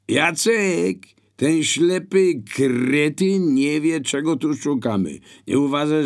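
high, loud burglars calling across the house; shouted exchange. A narrator's reading. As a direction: holler impatiently to your accomplice. Burglars call to each other.

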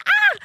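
high, loud imitated yelp; comically scared scream. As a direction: mimic a comically frightened scream. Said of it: "the way he, like, screams".

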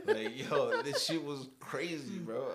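laughing hard mid-sentence; words breaking into laughter. Real, not acted. Laughing at his own blackout story.

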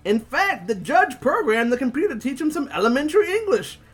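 high jeering punk, ending in a laugh; mocking punk voice. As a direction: sneer it out, building to a shout. Voicing Spikes in comic.

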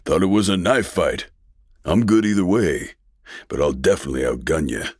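cocky colonel. A narrator's reading. Colonel taunting the war chief.

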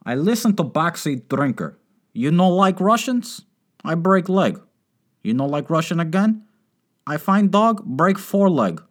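mock Russian mobster. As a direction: ham up a Russian thug in a thick Russian accent. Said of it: "You imagine?".

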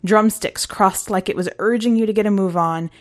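ordinary delivery, nothing marked

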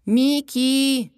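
high, loud call for someone missing. A narrator's reading. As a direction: call out a name across the water. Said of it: "wołała Zosia".

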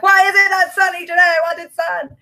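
mock yell at the sky. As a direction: rant at the rain like a cranky kid. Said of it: "yell and scream at the skies".

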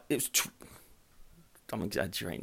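ordinary talk

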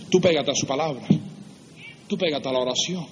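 ordinary talk, nothing marked

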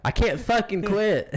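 high, loud laughing whine. Real, not acted. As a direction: laugh through it, exasperated. Laughing exasperated exclamation.

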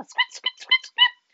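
high squeaks; mouse squeak. A narrator's reading. Narrator squeaking as the mouse again.

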